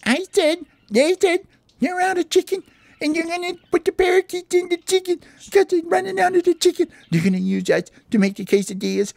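very high, frantic cartoon squeal; acted panic. Parakeet tells friends they will become quesadillas, shrieky panic. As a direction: squeal the bad news in hammed-up panic. Parakeet repeats the dreadful news.

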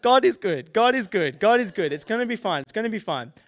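loud, high, repeated like a mantra; frantic self-reassurance. Retold or imitated. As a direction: play panicked reassurance, each phrase falling away. Panicked self-reassurance during a bleeding emergency.

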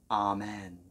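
usual speaking voice

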